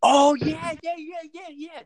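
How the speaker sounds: sudden high, loud "oh yeah"; real excited shout of recall; excited recognition. Real, not acted. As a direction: burst out as it comes back to you. Suddenly remembers the story.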